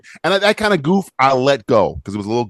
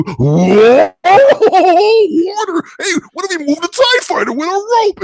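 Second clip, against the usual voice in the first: squeaky, much higher, louder mock voice with a laugh; goofy cartoon characters; 6 dB louder, slower than usual. Mocking the goofy scene that cut back in, high squeaky cartoon voice. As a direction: play it like a silly kids' cartoon. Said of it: "it cut back to".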